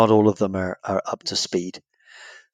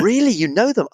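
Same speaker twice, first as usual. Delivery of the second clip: higher, impressed question; wide-eyed American; 9 semitones higher than usual. Retold or imitated. American in a bar asking the Brit, higher and amazed. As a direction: sound amazed and gullible.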